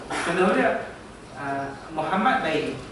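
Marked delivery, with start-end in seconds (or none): none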